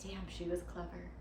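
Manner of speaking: soft, private murmur of admiration; hushed realization. Acted. Girlfriend talking to late Bubby, hushed and admiring. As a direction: speak softly to someone who has died. Moved, addresses the dead grandmother.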